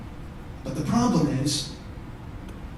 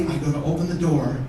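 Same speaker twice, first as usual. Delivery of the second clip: normal talking voice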